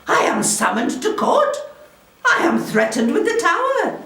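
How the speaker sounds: high-pitched theatrical outrage, rising to a peak; scandalised countess, pitched high; dramatic alarm. Acted. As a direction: build to an acted cry of indignation. Countess recounts being threatened with prison.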